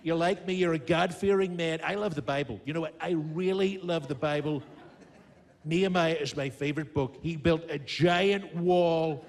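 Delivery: boastful American politician impression, drawing out key words; emphatic showman president with stretched superlatives; Donald Trump. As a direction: brag to the crowd in punchy, repeated phrases. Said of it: "now I've got Donald Trump".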